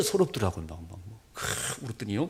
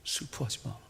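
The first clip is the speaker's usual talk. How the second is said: Jesus's words; 11 dB quieter than usual. Retold or imitated. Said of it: "뭐라 그러지요?".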